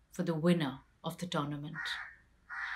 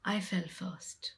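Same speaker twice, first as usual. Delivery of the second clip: hushed unvoiced whisper; 7 dB quieter than usual. Draupadi falling on the Himalaya road, whispered. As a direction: breathe it out as strength fails.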